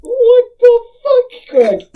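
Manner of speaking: high, loud, stunned disbelief; shocked outburst. Real, not acted. Shocked by the quiz option.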